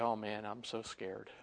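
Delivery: quietly hammed-up trembling; low, soft pretend fright; mock fear. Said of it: "he's trembling and saying".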